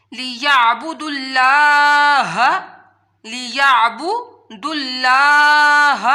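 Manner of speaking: لیعبدوا اللہ slow, with اللہ heavy and long; heavy Allah. Shown as a demonstration. Teacher reciting لیعبدوا اللہ with heavy اللہ. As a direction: end on a full heavy اللہ. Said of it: "لفظ اللہ کو موٹا پڑھا جاتا ہے".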